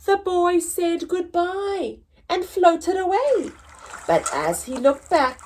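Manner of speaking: lively storybook narration, rising and falling; reading to children. Acted. Teacher reads Lost and Found to pupils, storytelling voice. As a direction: tell the story to a class of kids.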